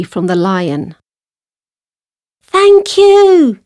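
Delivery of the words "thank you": loud, very high mouse call; shouted. A narrator's reading. Mouse fleeing the lion, high shouted thanks. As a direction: call out as an escaping little mouse. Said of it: "she shouted".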